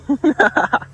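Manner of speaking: high, short burst of giggles; delighted laugh. Real, not acted. Delighted by the other dog.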